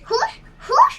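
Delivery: high sharp hush to chase off a dog; shooing hiss. Acted. Little sister shooing the stray dog, sharp hiss. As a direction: shoo the dog away with a hiss.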